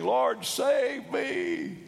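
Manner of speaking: high, drawn-out mock cry for help; Simon Peter crying out. Preacher as the sinking disciple, crying out in mock fear. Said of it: "cried out".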